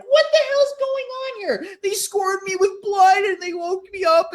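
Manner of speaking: mock upset teen; whining outrage. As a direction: tattle to mom. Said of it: "he's like".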